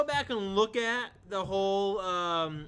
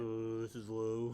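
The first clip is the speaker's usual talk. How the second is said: low voice; 7 semitones lower, 8 dB quieter, much slower than usual. Shown as a demonstration. Friend asked for his lowest note, slow low voice. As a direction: drop to the bottom of the range, slow. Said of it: "what's the lowest note you can hit".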